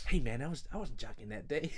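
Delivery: put-on drawled accent, mock-protesting. Podcaster mimicking the drummer's protest to a co-host, in a silly put-on voice. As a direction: do a daft impression of a protesting bandmate. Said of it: "and [name] goes".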